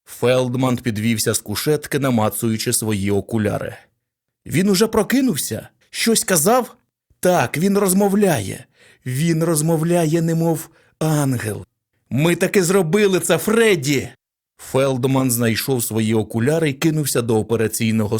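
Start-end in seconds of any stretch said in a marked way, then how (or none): 4.5-6.8 eager question. A narrator's reading. Eager questions after surgery. 7.2-14.1 joyful excitement. A narrator's reading. Joyful report to colleague.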